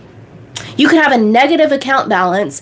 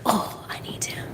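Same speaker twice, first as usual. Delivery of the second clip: whisper; 16 dB quieter than usual. Retold or imitated. Aphrodite's line, whispered.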